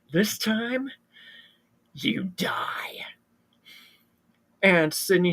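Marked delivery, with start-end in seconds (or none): 0.1-3.1 softer, whispered death threat; menacing whisper. Acted. Stu pinning Sydney down, whispering her death sentence. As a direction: lean in and breathe the final threat.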